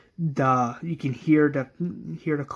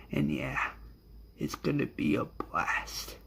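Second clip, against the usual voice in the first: villain's final words; 3 dB quieter than usual. Acted. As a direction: sink into a near-whisper, savouring each phrase. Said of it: "His final words are".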